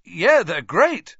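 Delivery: slow, loud, overdone praise; fake enthusiasm. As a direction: oversell it, clearly insincere. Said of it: "crossing his fingers".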